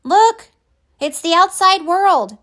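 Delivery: a small friend thrilled to see the world outside; excited. A narrator's reading. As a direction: burst out in delight. Pip sees the outside world.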